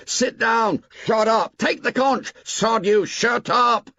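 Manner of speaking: put-on high, loud boys' yells; an angry mob shouting him down; crowd shouting. A narrator's reading. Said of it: "the storm broke".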